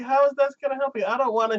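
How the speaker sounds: high, whiny imitation of a reluctant girl; high mock-whiny schoolgirl; mock girl protest. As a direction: pitch it up and whine the refusal. Girl voiced in retold scene.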